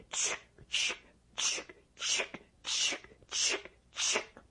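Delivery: unvoiced knife-on-whetstone chick; soft whetstone scrape; whispered chick chick. A narrator's reading. Knife sharpening sound effect.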